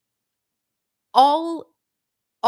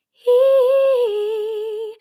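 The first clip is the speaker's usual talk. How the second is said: much higher, light, bright hee; light high larynx; 12 semitones higher, 7 dB louder than usual. Shown as a demonstration. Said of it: "that really light sound".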